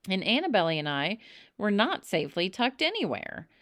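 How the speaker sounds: usual speaking voice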